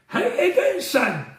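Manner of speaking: high-pitched imitation of a Scottish mum. Ronnie's mum greets the caller on the phone, high Scottish accent. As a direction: put on a mum's lilting Scottish greeting, pitched right up. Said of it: "did retain her Scottish accent".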